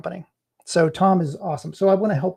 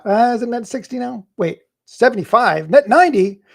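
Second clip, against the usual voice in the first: rising louder with each number; escalating exasperation; 6 semitones higher, 7 dB louder than usual. Real, not acted. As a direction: build up, exasperated. Escalating payment terms joke.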